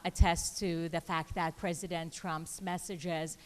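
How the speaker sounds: normal talking voice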